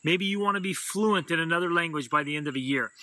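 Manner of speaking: normal talking voice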